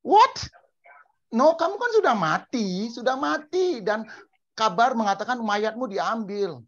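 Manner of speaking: high-pitched, loud disbelief; can't believe who is speaking; incredulous shock. Acted. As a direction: protest in acted astonishment. Acts Saul's disbelief at risen Jesus.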